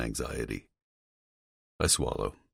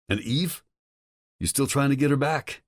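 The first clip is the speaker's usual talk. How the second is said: higher, agitated male voice on the phone; a worried friend pushing hard, voice raised in pitch; Tom's voice; 10 semitones higher than usual. Acted. Tom warning Vincent about his father, higher and agitated.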